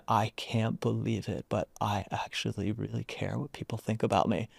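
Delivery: softly retold admission; honest confession. Man admits he cares what people think, softly sincere. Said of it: "a real honesty".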